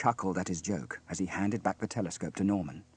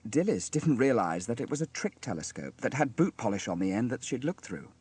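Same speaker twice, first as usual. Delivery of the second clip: usual speaking voice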